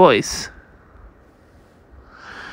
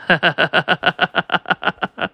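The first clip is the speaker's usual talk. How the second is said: laugh. Acted. Character laughs.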